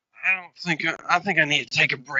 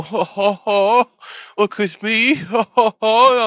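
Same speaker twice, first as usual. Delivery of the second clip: loud, higher jolly ho-ho laugh; 6 semitones higher, 9 dB louder, slower than usual. Acted. Jolly laugh for the stream chat in a powdered wig. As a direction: boom it out like a jolly old gent. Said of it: "I'm so jolly".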